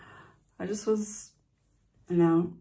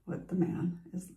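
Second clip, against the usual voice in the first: ordinary talk, nothing marked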